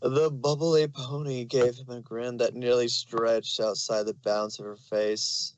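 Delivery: plain reading voice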